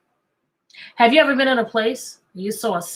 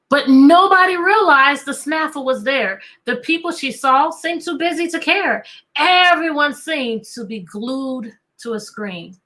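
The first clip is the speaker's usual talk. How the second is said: lightly voiced rhyming storybook read; reading to children; 2 semitones higher than usual. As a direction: read the rhyme to a class, unhurried. Reads the picture book aloud.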